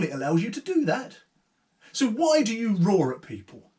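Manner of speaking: unafraid Eleanor. Acted. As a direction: press the ogre, not frightened at all.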